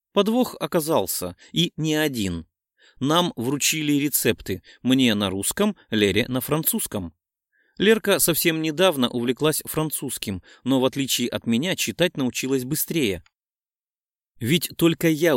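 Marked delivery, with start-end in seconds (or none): none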